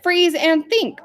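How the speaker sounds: game leader giving an order; foreman's command. Acted. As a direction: call out the instruction to children. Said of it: "And then if I said".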